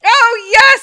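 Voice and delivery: loud, high eager cry; excited recognition. Real, not acted. Friend thrilled she knows the boat's name, loud eager cry. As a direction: burst out knowing the answer.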